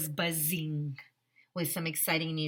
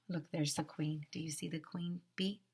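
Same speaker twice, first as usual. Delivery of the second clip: usual speaking voice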